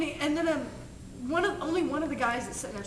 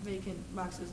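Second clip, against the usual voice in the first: quiet retold hint; 5 semitones lower, 10 dB quieter than usual. Retold passengers pointing out free seats.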